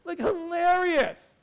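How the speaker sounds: high laughing delight; laughing at the memory of the movie. Real, not acted. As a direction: crack up, slowly. Laughing about the film.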